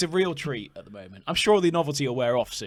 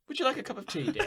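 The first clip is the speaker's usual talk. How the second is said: lightly voiced sweet old lady, laughing; a hint of a doddery granny offering tea; dotty absent-minded old lady; 10 semitones higher, 7 dB quieter, faster than usual. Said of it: "I turn my neck and I go".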